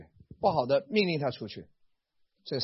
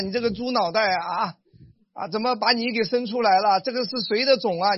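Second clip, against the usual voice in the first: mock scolding; 5 semitones higher, 4 dB louder than usual. Parent scolding child.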